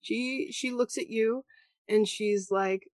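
ordinary talk

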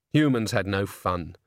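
usual speaking voice

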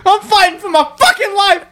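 high-pitched hammed-up plea; mock outrage. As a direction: wail it as a parody of desperation. Acted quote in same voice.

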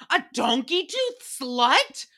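slow disbelieving question rising at the end; incredulous echo. Real, not acted. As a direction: repeat it like you can't believe it. Repeating the insult in disbelief.

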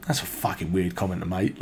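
low, muttered mock reproach; disapproving aside. Mates to Tugboat after his odd remark, muttered. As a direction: mutter it under your breath as the baffled mates. Mates' silent look voiced as words.

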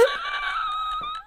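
high squealing burst of laughter; shrieking laugh. Real, not acted. Finishing her story to a friend, shrieking laugh.

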